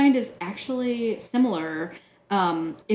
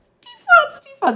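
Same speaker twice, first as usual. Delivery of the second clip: mock high squeal; 12 dB louder than usual. Mocking the wrong pronunciation.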